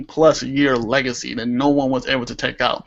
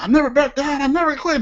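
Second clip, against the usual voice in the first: higher-pitched fired-up promo quote; 11 semitones higher, faster than usual. Retold or imitated. Fan quoting John Cena on the mic, fired up. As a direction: imitate a wrestler's fired-up vow. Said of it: "he's, like, fired up".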